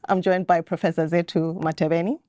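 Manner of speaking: usual speaking voice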